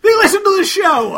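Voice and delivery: loud, high comic shout; sudden high-pitched outburst; shouted warning. Real, not acted. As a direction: yell it out like a warning. Mock alarm at joking about channel.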